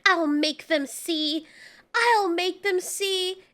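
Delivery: slow, drawn-out dramatic vow; mock-determined vow. Offended heroine vows to prove herself, mock-solemn and slow.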